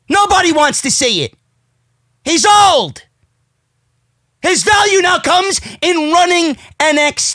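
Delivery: high-pitched exasperated rant. Real, not acted. Wrestling fan rejecting Triple H at WrestleMania, exasperated. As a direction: insist on it, pitch up.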